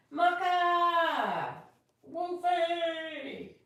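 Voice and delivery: real loud shout across the room; calling the dogs. Real, not acted. Reader breaks off the story to call her dogs, loud shout.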